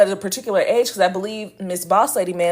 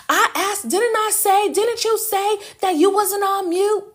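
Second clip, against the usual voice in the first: high, put-on mimicking voice of the boss lady; mocking boss lady; 12 semitones higher than usual. Mimicking the boss lady to the viewers, high and whiny. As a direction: mimic her whiny insistence, pitched way up. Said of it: "I knew I wasn't on mute. I asked".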